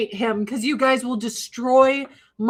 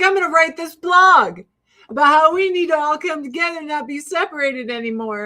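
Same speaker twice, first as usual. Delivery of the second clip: higher, louder mock-earnest announcement; stoned zeal; 5 semitones higher, 5 dB louder than usual. Stoned blogger announcing a grand plan, over-eager. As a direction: proclaim a stoner's big idea. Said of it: "super stoned".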